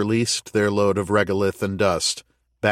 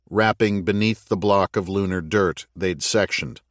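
ordinary delivery, nothing marked

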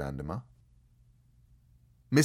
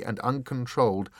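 ordinary delivery

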